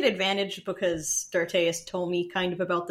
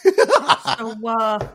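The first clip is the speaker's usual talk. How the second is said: laughing; 4 semitones higher, 10 dB louder than usual. Real, not acted. Laughing after the impression.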